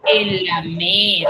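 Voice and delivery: "En la me" said slowly, marking the syllable "me"; slow it down to mark the stressed syllable "me"; slow, careful teacher's demo of "me". Shown as a demonstration. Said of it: "Recuerden que yo coloco".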